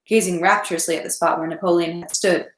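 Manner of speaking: plain reading voice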